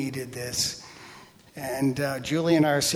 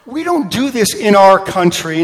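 loud, higher indignant scolding; raised-voice comic protest; ticked off; 5 semitones higher, 10 dB louder than usual. Retold or imitated. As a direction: scold with mock self-righteous outrage. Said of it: "I'm really kinda ticked".

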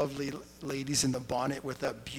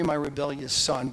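ordinary talk

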